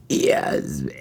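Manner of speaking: burped, high-pitched name; burping the words. Shown as a demonstration. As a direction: burp out the word Yasmin. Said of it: "I can do a better one".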